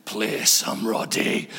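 put-on very low, slow drunken mumble; drunk old man. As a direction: sink down into a slurred plea. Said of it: "had one or two too many milkshakes".

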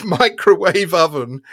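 punchline dissolving into laughter; laughing as the words come out; giggling out the punchline. Real, not acted. Punchline of placard story.

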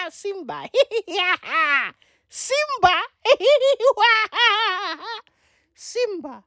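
high-pitched cackling hyena taunting Simba; Lion King hyena. Acted. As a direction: squeal and cackle like a cartoon hyena. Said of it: "Legit, I be out like".

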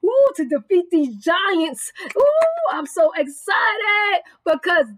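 excited preaching broken by high whoops; really excited. Real, not acted. Preacher thrilled about saints defeating giants, real excitement. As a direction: overflow with joy, whooping between phrases. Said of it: "that's why I'm so excited about these".

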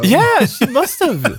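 high, drawn-out conviction; incredulous insistence. Real, not acted. Insists on absurd conclusion.

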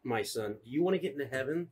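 low, soft conspiratorial sheriff; a hint of a shady lawman. Sheriff dealing drugs to a priest, low and soft. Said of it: "Sheriff's like".

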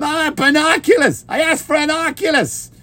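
high mock wail; quarrelling Boston family. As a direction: replay the squabble in exaggerated Boston.

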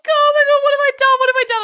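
high, loud panicked cry; mock panic. Friend imitating her panic after deleting the message, high and loud. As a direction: shriek it as if disaster struck. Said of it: "you're like".

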